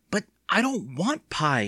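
put-on high, slow child's whine; wailing. A narrator's reading. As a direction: protest in a drawn-out wail. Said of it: "Evan wailed".

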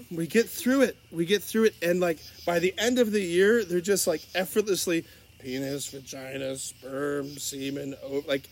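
normal talking voice

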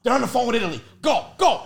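urgent warning. Retold or imitated. Kid warning siblings to hide, urgent mock alarm. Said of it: "I'm like".

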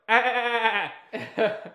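high, loud mock dolphin chatter; dolphin sound effect. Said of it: "doing sound effects".

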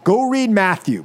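usual speaking voice

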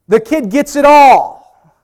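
very high, loud, slow refrain; triumphant proclamation. Acted. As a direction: shout the punchline, stretching the final word. Punchline refrain about the will.

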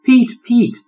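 high, loud overjoyed cry; joyful relief. A narrator's reading. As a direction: burst out thanking your rescuer. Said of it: "he burst out in joy and relief".

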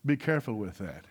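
a hint of a cautious uncle; uncle's warning. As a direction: quietly warn the boy like the uncle. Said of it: "but he told me".

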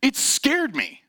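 high, loud, stammered protest; worked-up mock outburst, stretched at the end; angry, defensive driver. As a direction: imitate an indignant driver admitting fear. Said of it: "why are you so angry?".

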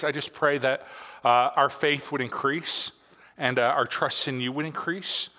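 hushed, slowing pastor's prayer. Acted. Pastor leads opening prayer.

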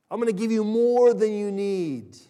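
loud, expansive declaration; lavish proclamation. Acted. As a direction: belt it out with huge generosity. God's lavish giving, acted out.

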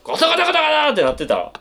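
fast, high rattling imitation; rattling sound effect. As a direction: mimic a shaking sign. Imitating shaking sign.

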